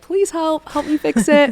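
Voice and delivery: high mock plea for help; pleading friends. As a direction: whine it like a friend begging for help.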